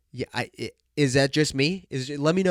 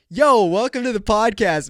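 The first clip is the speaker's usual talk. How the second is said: hyped intro; 7 semitones higher, 7 dB louder than usual. Acted.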